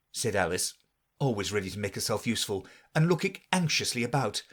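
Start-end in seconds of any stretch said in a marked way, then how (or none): none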